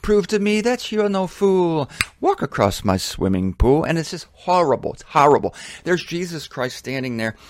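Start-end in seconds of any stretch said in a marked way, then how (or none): 0.0-3.8 sung-spoken taunt from Herod; mocking Herod. Preacher quoting Herod's song, playful mock taunt. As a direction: tease him like a showman king. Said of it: "he's mocking him".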